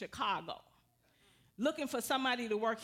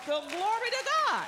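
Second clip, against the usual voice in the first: much higher and louder, bursting with praise; joyful praise shout; 10 semitones higher, 6 dB louder than usual. Real, not acted. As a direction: cry out to God in delight. Praising God over the offer.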